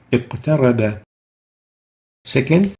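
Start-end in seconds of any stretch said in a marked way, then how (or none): 0.1-1.0 "اقترب" with a light bounce on qaf; "اقترب" with a small echo after q; lowest qalqala. Shown as a demonstration. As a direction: release the q of "اقترب" lightly, straight into ta. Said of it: "it's the lowest level of qalqala".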